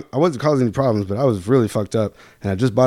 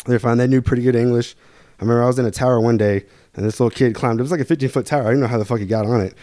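ordinary talk, nothing marked

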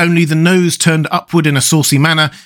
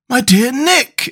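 much higher, slow, shocked exclamation; exclaimed; 12 semitones higher, slower than usual. A narrator's reading. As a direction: gasp at what a friend did. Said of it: "exclaimed the Scarecrow".

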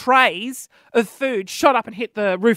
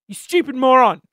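loud, exasperated jab; frustrated insult; 5 dB louder than usual. Real, not acted. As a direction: let the frustration burst out on the insult. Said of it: "can you tell I'm really frustrated?".

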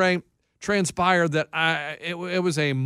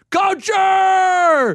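high, loud, long-held yell; imitating a fan hollering a greeting; retold shouted catchphrase; 13 semitones higher, 5 dB louder than usual. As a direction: belt it out and stretch the ending. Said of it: "he was like".

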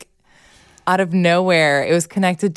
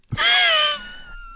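shrieking laugh. Real, not acted. Mom laughing at the story.

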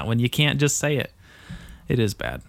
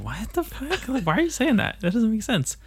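incredulous, high-pitched protest; baffled protest; 11 semitones higher than usual. Acted. Friend reacting to a senseless insult, baffled and high-pitched. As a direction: act out total bewilderment, voice way up.

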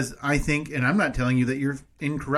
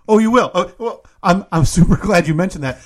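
louder, higher, eager pounce; excited gotcha; 4 semitones higher, 8 dB louder than usual. Real, not acted. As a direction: jump on the slip with glee. Teasing gotcha.

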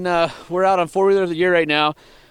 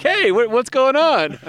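higher, drawn-out mock group hail; raised-pitch, slowed call of surprise; calling out greeting; 8 semitones higher, slower than usual. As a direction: imitate a crew calling over, stretched out. Acted greeting of the twelve onlookers.

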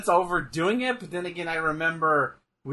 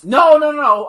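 much higher and louder no no; sudden correction; 10 semitones higher, 12 dB louder than usual. Real, not acted. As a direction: catch your own mistake.

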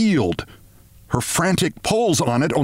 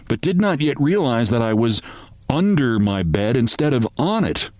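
plain reading voice

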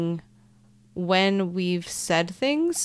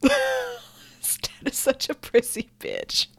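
laughing; 14 semitones higher, slower than usual. Real, not acted. Mocking Lestat, laughing.

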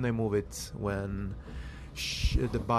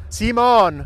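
loud, high call across a distance; raised-voice holler to a friend; 11 semitones higher, 11 dB louder than usual. Real, not acted. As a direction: shout the name to get his attention. Calls to friend out of earshot.

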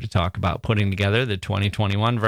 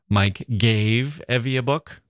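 book stressed with a rising finish; slower than usual. Shown as a demonstration. Host demos stress on book. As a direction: land on book, let it rise. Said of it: "emphasizing a single word".

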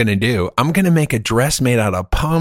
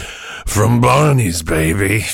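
cool artist voice; much slower than usual. Pretend. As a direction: play the smug artist, lay it back slow. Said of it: "Ask me a question. I'll be the artist".

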